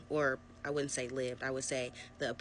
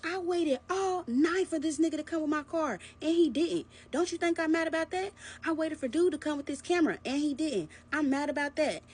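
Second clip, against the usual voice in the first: much higher, louder whiny mimicry; mocking his whine; 11 semitones higher, 4 dB louder than usual. Boyfriend complaining he waited all night, whiny mockery. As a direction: whine it like a sulking child. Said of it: "He was like".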